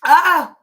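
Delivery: a sudden loud cry of joy; excited shout. Real, not acted. Streamer sees fifty subscribers, excited shout.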